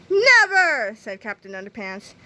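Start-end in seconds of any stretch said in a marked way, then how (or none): 0.1-1.0 high, loud refusal; defiant cry. A narrator's reading. Captain Underpants refuses to bow to Melvin, defiant cry. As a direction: throw it back loudly with falling pitch. Hero defies the villain's command.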